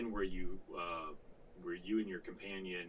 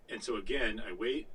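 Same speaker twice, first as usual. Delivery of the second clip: normal talking voice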